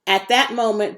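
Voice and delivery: plain reading voice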